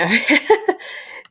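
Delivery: high giddy squeal of a laugh. Real, not acted. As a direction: let out a thrilled giggle. Giddy after praising the kiss.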